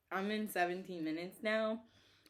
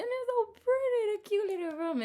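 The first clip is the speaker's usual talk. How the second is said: much higher and louder, gushing; gushing delight; 7 dB louder than usual. Real, not acted. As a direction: swoon over the cute room. Gushing over the pretty room.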